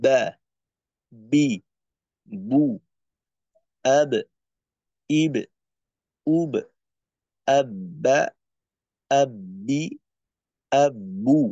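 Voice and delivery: বা বি বু with a hard closed stop, আব্বা with a doubled firm B; firm Arabic B drill. Shown as a demonstration. Teacher drilling a firm B for students, hard stops. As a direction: pop each B firmly. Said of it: "আওয়াজটাকে শক্ত করতে হবে".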